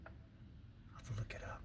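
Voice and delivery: soft unvoiced aside to the camera; whispered aside. Real, not acted. Telling viewers a secret aside, whispered. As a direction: lean in and whisper it.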